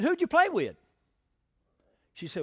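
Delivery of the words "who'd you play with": gentle dad question. Retold or imitated. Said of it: "I said".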